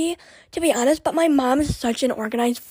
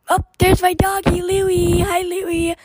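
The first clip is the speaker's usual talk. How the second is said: dog-greeting voice; 6 semitones higher, slower than usual. Real, not acted. Greeting her dog Louie, high happy baby talk. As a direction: coo at the puppy.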